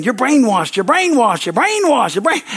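climbing, loud mocking yell; retold yelling. Angry visitor yelling at the pastor, rising loud mock yell. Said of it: "he's yelling at me".

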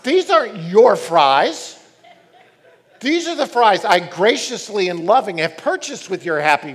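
loud, sarcastic parent rant; a parent snaps back at a stingy kid; exasperated parent. Retold or imitated. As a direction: tell the kid off, loudly and sarcastically. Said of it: "I wanna say".

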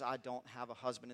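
very quiet, pained admission; a soft, aching confession; mournful. A narrator's reading. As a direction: let the shame weigh on it. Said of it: "the mourning in her voice and the pain".

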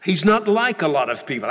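ordinary talk, nothing marked

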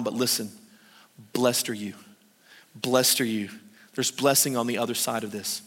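low, soft, motherly comfort; tender cousin. Retold or imitated. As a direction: soothe a scared young woman. Elizabeth comforting frightened Mary.

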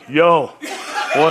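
comic Philly tough-guy voice; mock bodyguard talk; Rocky. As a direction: imitate a boxer's street greeting. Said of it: "like Rocky".

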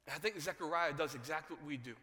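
ordinary delivery

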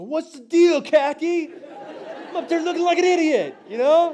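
mock exasperated shout. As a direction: yell it in a comic high voice, fed up. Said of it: "I go".

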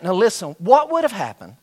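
ordinary talk, nothing marked

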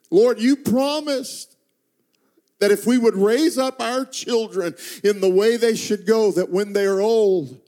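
high, imploring retold prayer; crying out to God. Parents praying at night for their son, pleading cry retold. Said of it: "cry out and weep together and say".

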